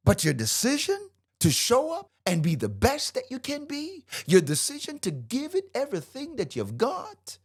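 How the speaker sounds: high, insistent preaching cadence; impassioned, worked-up exhortation; fired up. Real, not acted. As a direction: pile up the phrases, pitch held high. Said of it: "I'm very fired up when I teach".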